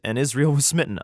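normal talking voice

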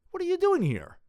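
high, loud cry of disbelief; alarmed outburst. Acted. Son realising his father is in a dungeon, alarmed outburst. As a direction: burst out in shocked alarm.

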